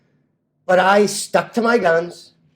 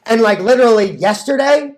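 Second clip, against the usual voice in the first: usual speaking voice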